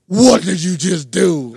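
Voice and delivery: slow, loud, put-on angry voice; mock angry demand. Retelling how his angry friend snapped at him. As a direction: act out the friend's sudden fury. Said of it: "turned into a demon all of a sudden".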